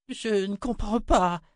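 high, slow, bewildered plea; faint gasped confusion. A narrator's reading. Six facing her mother, bewildered. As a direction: falter in high-pitched confusion. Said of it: "souffla Six".

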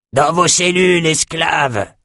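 high-pitched commanding cry; shouted order. Acted. Trainer to escaped slaves, shouting an order. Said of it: "cria un de ces sales types".

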